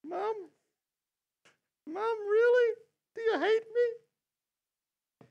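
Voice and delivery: very high, plaintive mock kid voice; squeaky, wailing imitation of a worried kid; child questioning parent. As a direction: play a hurt little child, drawing out the last words. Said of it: "The kids are looking at the parents".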